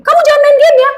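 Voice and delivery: high, loud parent telling off; scolding parent. Retold or imitated. A parent forbids the child from gaming, high and loud.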